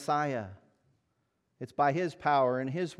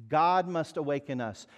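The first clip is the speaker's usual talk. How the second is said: ordinary delivery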